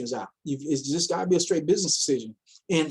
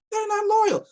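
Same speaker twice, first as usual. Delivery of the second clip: much higher, punching "loyal"; exasperated high repeat; 14 semitones higher, 3 dB louder than usual. Real, not acted. Repeating to viewers that platforms lie, high exasperated. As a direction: fling "loyal" up high in disbelief. Repeating his warning for emphasis.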